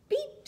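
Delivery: high, short horn beep; little truck beep. A narrator's reading. Blue greets the toad, bright high beep. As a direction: squeak out a tiny beep. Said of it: "said Blue".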